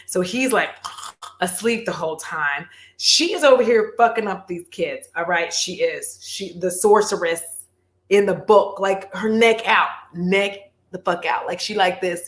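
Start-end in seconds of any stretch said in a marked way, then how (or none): none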